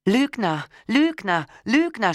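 screaming liar. A narrator's reading. Wife screaming at her husband, furious and high. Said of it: "schrie ich".

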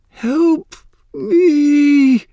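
shrill-pitched, full-voiced scream for help; terrified scream. A narrator's reading. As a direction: scream it out in panic, words drawn out. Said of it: "she screamed, her voice muted by the small space".

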